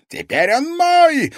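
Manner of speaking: high, loud, drawn-out triumph; exultant villain's shout; rapturous cry. A narrator's reading. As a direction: crow over the prize at last. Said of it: "восторженно воскликнул мастер зеркал".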